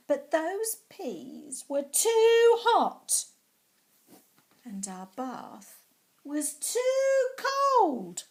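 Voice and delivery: high, loud, slow whine; whiny complaining. Acted. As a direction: stretch out every "too" in protest. Child complaining at bathtime.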